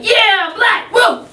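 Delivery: high, loud rock-concert whoop; excited cheer. Acted. Proud young woman cheering at a rock show. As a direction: pump the fist and holler it.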